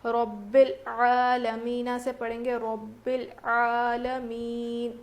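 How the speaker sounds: slow, drawn-out recitation voice; tajweed recitation. Shown as a demonstration. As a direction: recite it as a model for students. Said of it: "سانس توڑیں گے". (تلاوت کے انداز میں آہستہ پڑھنا)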